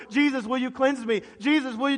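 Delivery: high, loud, repeated plea; fervent, insistent pleading; imploring. Acted. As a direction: beg him over and over. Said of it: "implore means he's asking him over and over".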